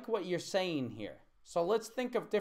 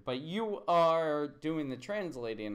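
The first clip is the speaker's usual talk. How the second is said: normal talking voice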